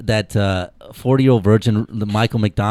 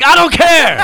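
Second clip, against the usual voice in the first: pompous shrill tyrant; 7 dB louder than usual. Pretend. Mock dictator Thanos.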